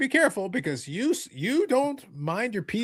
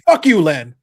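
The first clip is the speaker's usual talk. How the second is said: loud, taunting curse; defiant shout; 3 semitones lower, 12 dB louder, slower than usual. Retold or imitated. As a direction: spit it at him.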